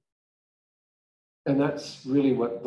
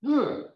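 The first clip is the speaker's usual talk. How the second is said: a sudden, much higher exasperated ugh; frustrated groan; 14 semitones higher, 3 dB louder than usual. Real, not acted. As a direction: let the frustration burst out as a groan. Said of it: "I was just so frustrated".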